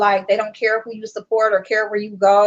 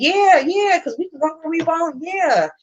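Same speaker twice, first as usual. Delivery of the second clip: mocking fake agreement; 7 semitones higher than usual. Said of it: "they'll say some real shit".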